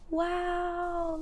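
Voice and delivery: ordinary delivery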